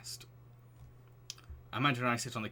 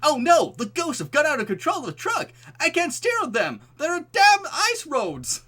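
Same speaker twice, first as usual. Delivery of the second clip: much higher, loud hammed-up panic; panicked trucker; 14 dB louder than usual. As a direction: squeal in mock terror at the wheel.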